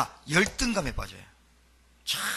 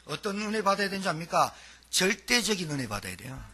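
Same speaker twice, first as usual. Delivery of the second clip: ordinary talk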